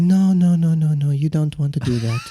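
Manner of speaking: Joe Haas. Acted. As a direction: mimic a mild man calmly declining. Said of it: "very quiet and passive in the way he talks".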